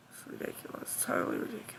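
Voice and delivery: soft, toneless mutter after a sigh; whispered grumble. Real, not acted. Fed-up streamer muttering to herself, whispered. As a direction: breathe it out in disgust.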